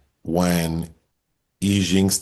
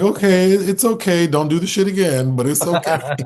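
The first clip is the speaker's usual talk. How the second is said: high-pitched mock reassurance; imitated forgiving shopkeepers; 10 semitones higher, 3 dB louder than usual. Shop owners excusing the girl who stole, mock reassuring. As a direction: forgive in a raised, put-on shopkeeper voice.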